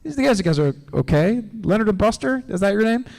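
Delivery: relieved dog voice. Retold or imitated. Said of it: "and he's like".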